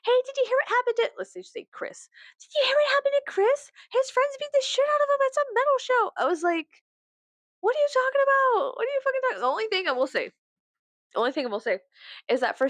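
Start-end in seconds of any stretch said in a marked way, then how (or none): 0.0-1.1 put-on high, fast gossipy girl voice; imitated gossiping girls. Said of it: "these girls came up to me like". 2.4-6.1 put-on very high gossipy girl voice; imitated gossiping girls. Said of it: "these girls came up to me like". 7.6-9.4 high, hammed-up disbelief; mock exasperation. Acts baffled reply to the girls.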